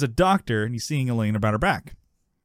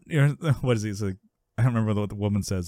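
normal talking voice

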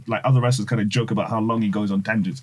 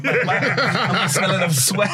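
high, laughing self-mockery; 7 semitones higher, slower than usual. Telling friends he smelled of sweat, laughing high. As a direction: giggle through the words, slower. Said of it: "I was like".

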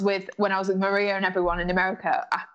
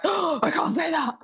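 retold alarm; 8 semitones higher than usual. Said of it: "and I was like".

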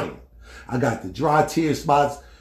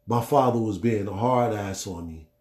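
ordinary talk, nothing marked

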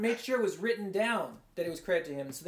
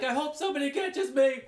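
higher, louder desperate call from a rooftop; hopeful yell; 6 semitones higher, 4 dB louder than usual. Retold or imitated. Preacher imitating a jumper hoping someone catches him, yelling. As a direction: shout it out, hoping to be caught. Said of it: "you're like".

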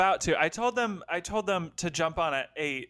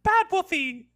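scolding; 11 semitones higher, 4 dB louder than usual. Pretend. Scolding wolf like a pet.

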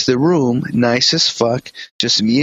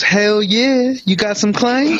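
mock eager; 9 semitones higher, slower than usual. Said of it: "So Jessica goes".

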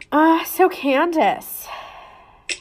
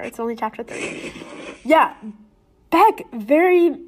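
usual speaking voice

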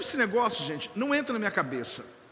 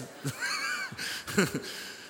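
high shrieking burst of laughter; cackling laugh that cracks up high; 5 dB quieter than usual. Real, not acted. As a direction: break into a real laugh. Pastor bursts out laughing mid-sermon.

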